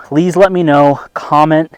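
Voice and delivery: normal talking voice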